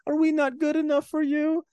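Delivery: high, put-on wounded plea; hammed-up whine of spurned brides; Dracula's brides. Voicing Dracula's brides, introduced as quote.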